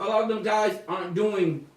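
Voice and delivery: ordinary delivery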